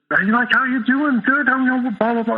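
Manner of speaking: high-pitched, hearty small talk; lively mock greeting, voice lifted high; affable friend. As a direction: imitate a gregarious pal's effusive chatter. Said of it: "affable, right? He's very".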